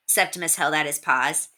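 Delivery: plain reading voice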